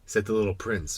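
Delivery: usual speaking voice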